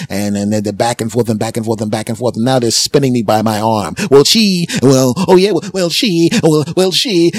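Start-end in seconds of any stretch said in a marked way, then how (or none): 4.1-7.3 high, loud stammering; sputtering argument. Retold or imitated. Two cashiers talking over each other, high and loud. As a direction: trip over words in the fight.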